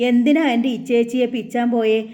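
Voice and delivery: usual speaking voice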